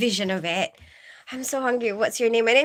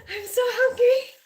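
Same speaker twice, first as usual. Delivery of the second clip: whine; 12 semitones higher than usual. Real, not acted. Whining about hunger.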